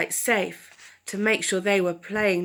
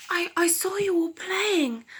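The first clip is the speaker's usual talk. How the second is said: shy owl speaking up; high owl voice; 9 semitones higher than usual. Acted. Owl telling the cubs he watched them, high and shy. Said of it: "Owl said".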